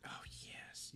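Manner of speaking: hushed, whispered approval; very soft, voiceless yes; whispered delight. Real, not acted. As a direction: whisper it with relish. Relishes hearing the film named.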